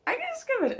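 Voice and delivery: giddy high-pitched repeat; squealed glee. Real, not acted. Delighted at skipping scenes.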